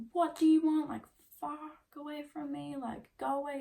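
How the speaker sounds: high petulant mockery of an angry teen; mocking his whine. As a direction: sneer it back in his whiny voice. Quoting his angry texts mockingly.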